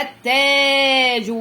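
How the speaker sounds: teacher's slow, drawn-out التاج; long madd. Shown as a demonstration. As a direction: stretch the vowel after the doubled ت in التاج. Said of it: "مد".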